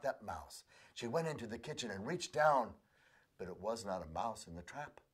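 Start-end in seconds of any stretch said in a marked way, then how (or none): none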